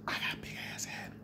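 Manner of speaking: soft whisper, confiding; whispered aside. Real, not acted. Joking about his big head to viewers, whispered. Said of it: "whispered aside".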